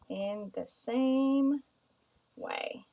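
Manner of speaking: slow, drawn-out "In the same way", the last word dropping to a breath; slow dictation. Shown as a demonstration. Teacher dictating to students, slow and drawn out. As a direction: spell out "In the same way" slowly while writing it. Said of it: "So I'm gonna say".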